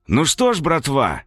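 cheerful mocking greeting; mocking cop greeting. A narrator's reading. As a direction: hail them with mock cheer.

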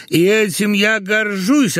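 high, slow, metrical declamation; suspiciously iambic tone. A narrator's reading. Husband boasts to his wife in suspiciously iambic tone. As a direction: declaim it proudly like a verse line. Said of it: "подозрительным по ямбу тоном".

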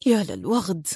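soft, low mutter; very faint mutter. A narrator's reading. Woman cursing the commander's cleverness to herself, muttering. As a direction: mutter it under the breath. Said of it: "متمتمة في خفوت شديد".

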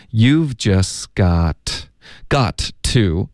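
very slow "You've just", the t in "just" dropped; teacher's drawn-out model with "got to" unreduced; slow pronunciation demo. Shown as a demonstration. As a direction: spell out "got，got to" word by word. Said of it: "干脆的停顿都不需要".

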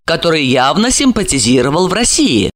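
ordinary delivery

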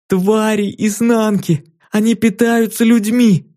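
put-on high, slow fearful child's warning; frightened little girl. A narrator's reading. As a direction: warn her of the monsters. Girl warns.

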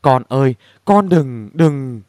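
narrated weak plea in an altered voice; a touch of faint, faltering distress; feeble murmur. A narrator's reading. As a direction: plead haltingly, as if barely conscious. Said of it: "Miệng của bà thều thào".

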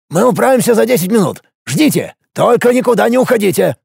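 a minister hurrying to help; eager minister. Acted.

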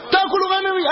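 hammed-up fool shrieking in disbelief; mock outrage rising. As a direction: push the fool's disbelief right up. Fools' quarrel escalating.

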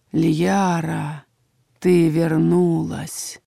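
very slow, drawn-out low voice; slow, ominous man from the dark; low male voice. A narrator's reading. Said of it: "прозвучал низкий мужской голос".

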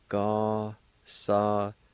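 Gasadoysti spoken slowly, A left silent; slow careful demo. Shown as a demonstration. Teacher modeling Gasadoysti for learners. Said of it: "pronounced like this".

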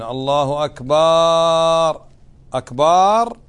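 "أكبر" stretched with a long drawn vowel like a drumbeat; drawn-out muezzin's takbir. Shown as a demonstration. Teacher mocking some muezzins' wrong drawn takbir. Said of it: "هذا خطأ".

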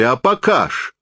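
high, eager exclamation; sudden joint cry. A narrator's reading. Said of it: "враз вскрикнули". (внезапный высокий выкрик)

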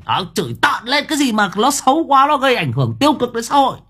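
high, loud, insistent tirade; heated rant. Real, not acted. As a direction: raise the voice and hammer the point home. Rants about what must be cursed. (giọng cao, to, hăng máu chửi)

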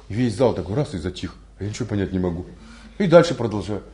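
usual speaking voice

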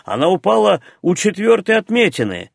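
high, eager announcement; excited exclamation. A narrator's reading. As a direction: call it out like a thrilled onlooker. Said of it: "хором воскликнули присутствующие".

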